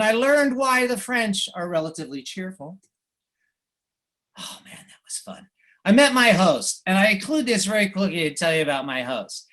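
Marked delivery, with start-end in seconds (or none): 4.4-5.5 breathy whisper, barely voiced; whispered aside. Real, not acted. Speaker to audience, recalling the fun, in a whispered aside. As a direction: let it slip out under your breath. Fond aside about French drinking.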